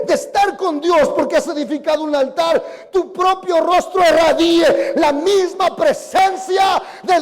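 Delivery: loud, impassioned sermon delivery; a pastor pressing his flock to build an altar; preaching at full force. Acted. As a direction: hammer each line home to the congregation. Pastor preaching, climaxing in praise.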